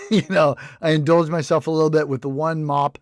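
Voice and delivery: usual speaking voice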